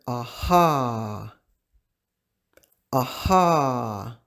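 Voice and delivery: aha said slowly, ah-HA, open ah vowels both times; aha, drawn-out ah sounds, like a teacher modeling it; model word aha. Shown as a demonstration. Teacher modeling aha for learners, open ah vowels. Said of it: "we have the first word".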